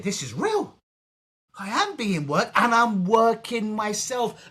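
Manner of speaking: mock sudden realization. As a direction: act out a client waking up to it. Acts out a client's realization.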